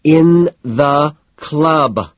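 each word drawn out, high and loud; very slow, bitter emphasis; bitter, spaced out. A narrator's reading. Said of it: "spacing each word out bitterly".

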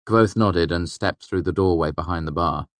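ordinary delivery, nothing marked

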